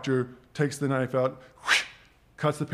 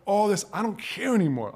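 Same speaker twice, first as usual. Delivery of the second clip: passionate outburst; 7 semitones higher than usual. Retold or imitated. Quitting outburst.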